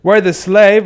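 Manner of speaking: sudden loud exclamation; loud outburst. Real, not acted. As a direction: blurt it out loud.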